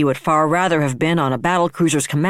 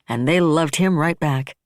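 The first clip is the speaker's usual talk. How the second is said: usual speaking voice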